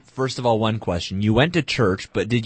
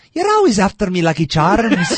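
much higher, louder Irish leprechaun voice; 11 semitones higher, 6 dB louder than usual. Pretend. Co-host mocking his own Irish accent, high leprechaun Irish. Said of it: "go high like a leprechaun".

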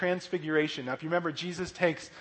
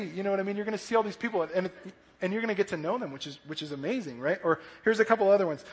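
ordinary talk, nothing marked

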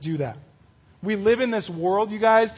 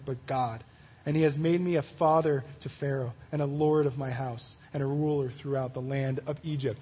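softer, measured Bible reading to the group; scripture reading; 5 semitones lower, 6 dB quieter than usual. Acted. Reads Genesis 45 aloud.

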